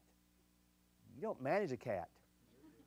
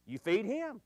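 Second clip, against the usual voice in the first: teasing list; 4 semitones higher than usual. Acted. Same list applied to husbands, comic.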